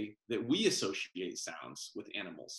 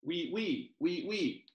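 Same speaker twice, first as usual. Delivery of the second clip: mosquito buzz; 6 semitones higher than usual. Acted. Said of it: "mosquito sound in story".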